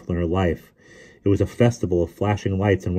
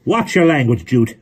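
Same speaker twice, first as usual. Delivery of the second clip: loud, high-pitched scolding call; 6 semitones higher, 6 dB louder than usual. A narrator's reading. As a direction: tell off the driver at full voice. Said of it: "Missus Zimmerman called".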